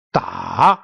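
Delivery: "Da" dipping low, then climbing; falling-rising tone. Shown as a demonstration. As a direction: draw "Da" down and then up. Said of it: "going down and then upward".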